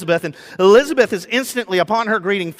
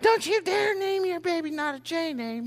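high, strained, softer mock scolding; mother-in-law's warning; 12 semitones higher, 7 dB quieter than usual. Mother-in-law warns about baby name, high mock scolding. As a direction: scold in a high, held-back comic voice.

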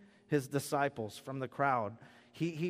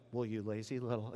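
mock scold; 5 semitones lower, 8 dB quieter than usual. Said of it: "And then they'll go".

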